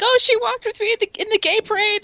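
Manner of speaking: high, gushing retold excitement; excited. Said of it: "texted me just all excitedly".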